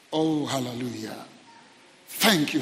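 ordinary delivery

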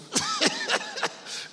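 high-pitched laugh. Real, not acted. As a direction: laugh at your own joke. Laughs at own retold joke.

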